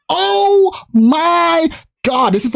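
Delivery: high, very slow gasp of wonder; awestruck. Retold or imitated. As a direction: draw it out, dazzled and high. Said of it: "I'm like".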